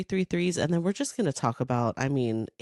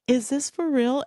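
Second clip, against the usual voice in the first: excited disbelief; 9 semitones higher than usual. Retold or imitated. Excited reaction to day party.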